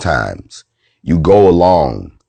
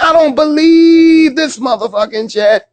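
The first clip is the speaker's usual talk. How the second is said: mock incredulity. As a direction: re-enact stunned disbelief in a squealing high voice. Acting out his disbelief afterwards.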